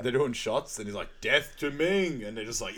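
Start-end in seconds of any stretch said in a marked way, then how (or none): 1.2-2.2 long, loud, higher-pitched mock war cry; retold battle cry. Co-host retelling Flash Gordon's shots scene, mock battle cry. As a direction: draw it out like a hammy battle cry.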